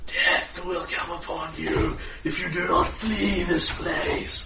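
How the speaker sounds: slow ghostly whisper with a laugh; demonic whisper. Acted. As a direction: menace them in a whisper. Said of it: "Sounds like he's whispering in your ear".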